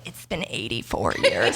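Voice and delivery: put-on low, slow, old lady quoting Titanic; old Titanic woman. Quoting the old woman from Titanic, slow and low. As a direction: croak it out like the aged Rose. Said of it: "the woman in Titanic that's like".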